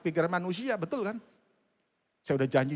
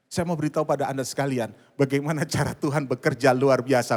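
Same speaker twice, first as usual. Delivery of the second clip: loud declaration building to acclaim; forceful preaching; 7 dB louder than usual. Real, not acted. Preacher telling congregation to give God glory, building forcefully. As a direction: proclaim it powerfully to the crowd.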